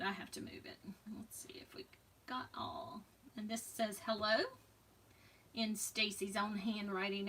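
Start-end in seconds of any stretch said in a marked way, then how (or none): 0.0-0.8 repeated under the breath in a whisper. Real, not acted. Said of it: "if I have to move it". 1.2-3.0 whispered to herself while checking. Real, not acted. Checking her materials quietly.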